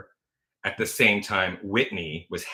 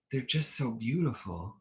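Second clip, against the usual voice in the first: lightly voiced little kid, soft and dreamy; a hint of a small child in awe; six-year-old; 3 semitones higher, 7 dB quieter than usual. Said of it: "imagine, like, a six-year-old saying that".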